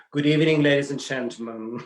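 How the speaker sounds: attempted British announcer; hammed-up British emcee, not quite convincing; British accent. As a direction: address the hall in a mock British accent. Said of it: "in a British accent".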